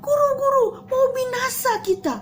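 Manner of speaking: high frightened cry; panicked yelling. Acted. Frightened disciples shout to their teacher, panicked. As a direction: shriek it like sinking men. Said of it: "sampai mereka teriak-teriak".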